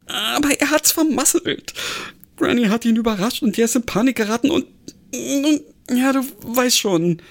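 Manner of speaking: panicky Alfie. A narrator's reading. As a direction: rush it out, voice climbing high.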